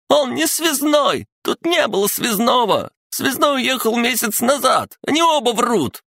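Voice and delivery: very high, shrieked denials; hysterical squealing. A narrator's reading. Panicking son gives away the secret, squealing hysterically. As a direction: squeal every sentence in panic. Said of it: "завизжал Николас".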